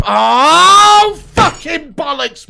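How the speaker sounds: loud, high shout of disgust, drawn out; furious outburst. Real, not acted. Fan watching his team concede a chance, furious shout. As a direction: blow up at the defender's mistake. Fan sees defender beaten.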